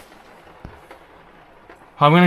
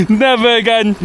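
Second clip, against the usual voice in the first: high, loud fed-up cry; exasperated outburst; 8 semitones higher, 5 dB louder than usual. Real, not acted. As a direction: near laughing, never again. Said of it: "one time and one time only".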